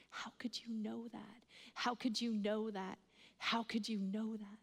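hushed, half-whispered mock weeping; imitated crying. Drug dealer stunned by the prayer, imitated tearful hush. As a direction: softly act out a man overcome, barely voicing it. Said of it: "and starts crying".

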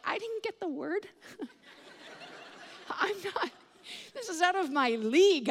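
a helpless giggle of being out of your depth; laughing flustered. Retold or imitated. Preacher laughing while asked to pray.

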